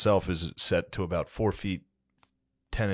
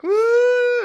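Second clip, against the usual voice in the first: very high, loud mock wail; fake baby cry; 9 dB louder than usual. Uncle tricking hurt nephew out of tears, fake crying wail. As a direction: burst out in an imitation crying howl. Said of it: "he's surprised that I'm crying".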